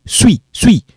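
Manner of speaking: suis said as swi, final s silent. Shown as a demonstration. Teacher drills suis with no s. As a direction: drop the s, just swi. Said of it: "we don't pronounce it".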